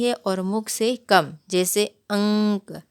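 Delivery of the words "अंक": अंक said slowly with the nasal ank sound stretched; teacher spells out the nasal in अंक; nasal anusvara demo. Shown as a demonstration. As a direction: draw out the nasal in अंक for the class. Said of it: "इसका उच्चारण करते समय नाक से अधिक वायु निकलती".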